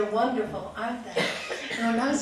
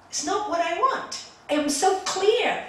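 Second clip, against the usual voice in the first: emphatic declaration; 9 semitones higher, 8 dB louder than usual. Retold or imitated. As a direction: insist with raised pitch and full voice. Declares own stance emphatically.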